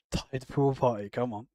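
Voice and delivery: ordinary talk, nothing marked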